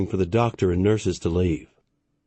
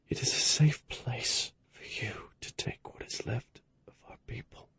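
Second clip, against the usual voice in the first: dying man's last instructions; extremely weak voice; 3 semitones higher, 9 dB quieter than usual. A narrator's reading. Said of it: "his voice was extremely weak".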